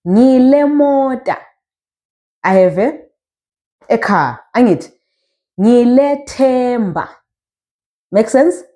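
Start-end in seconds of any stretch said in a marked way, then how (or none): 0.1-1.4 "Ngile mota" slow, higher, drawn out; slow, raised teacher's demo of "Ngile mota"; model pronunciation. Shown as a demonstration. Teacher models phrase for learners. 5.6-7.1 "Ngile themba" slow and drawn out; slow teacher's demo of "Ngile themba"; model pronunciation. Shown as a demonstration. Teacher models phrase for learners.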